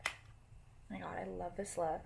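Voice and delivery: breathy awed whisper, almost no voice. Acted. Admiring her own makeup, whispering in awe. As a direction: whisper it to yourself like a secret delight.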